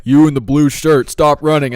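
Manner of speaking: loud, slow megaphone command; mock cop order. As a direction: bark it over a loudspeaker.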